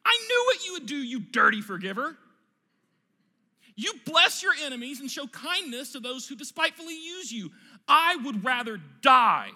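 hammed-up furious screaming; screamed tantrum. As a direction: rage at a forgiving God. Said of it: "he screamed".

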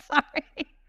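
high, teary little apology; tearful. Real, not acted. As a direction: apologise through a tearful catch in the voice. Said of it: "I'm gonna cry my way through this".

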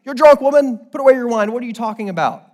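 loud, high, exasperated telling-off; scolding priest. Acted. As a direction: berate her loudly, easing toward the end. Acting out Eli's scolding.

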